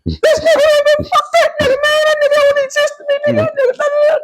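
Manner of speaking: hysterical, very high-pitched ranting; going crazy. Retold or imitated. Son venting to mom after fight with dad, going crazy. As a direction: rant fast and high, beside yourself. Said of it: "I'm just going crazy".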